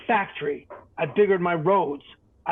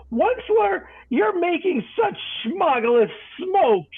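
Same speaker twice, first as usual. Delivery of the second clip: croaking cry; 11 semitones higher, slower than usual. A narrator's reading. The coughing Lorax crying out at the Once-ler, in a high croak. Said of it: "he cried with a cruffless croak".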